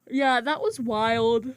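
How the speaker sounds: slow, yawned words; yawning. Real, not acted. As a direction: drag it out mid-yawn. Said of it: "Sorry, I'm yawning now".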